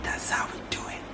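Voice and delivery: soft breathy whisper. Acted. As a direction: whisper it like a secret. Whispered sign-off after laugh.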